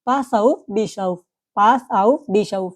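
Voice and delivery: slow, careful teacher model of the phrase; slow German demo. Shown as a demonstration. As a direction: say each word slowly so students can copy. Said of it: "কি বলবেন?".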